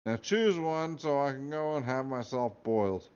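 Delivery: weary Scottish hat. Acted. Hat lists houses wearily.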